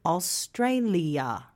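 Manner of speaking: Australia said slowly, syllable by syllable. Shown as a demonstration. Teacher showing learners the four syllables of Australia. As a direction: spell out Australia in slow sounds. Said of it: "Australia has four syllables".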